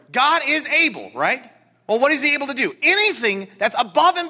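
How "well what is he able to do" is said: ordinary talk, nothing marked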